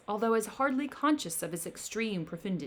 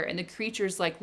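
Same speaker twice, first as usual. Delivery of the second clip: plain reading voice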